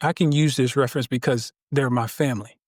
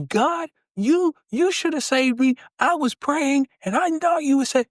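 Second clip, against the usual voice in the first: much higher, whiny complaint to God; upset man complaining; 11 semitones higher than usual. Acted. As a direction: plead that you were let down.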